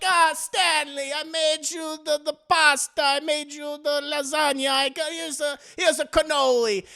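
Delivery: high, loud Italian mamma voice; Italian mother. Retold or imitated. Italian mother fussing over Stanley with food, high Italian mamma voice. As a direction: dote on him like an Italian mamma. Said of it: "his super Italian mother".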